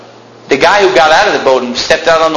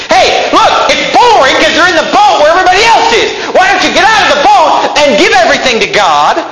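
preaching at full force; 11 semitones higher than usual. Acted. Preacher urging the congregation, shouting with fervor. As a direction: thunder it at the congregation.

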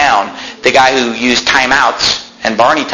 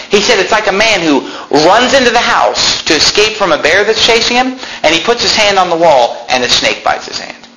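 heated preaching; 7 semitones higher than usual. Acted. Preacher quoting Bible, voice raised.

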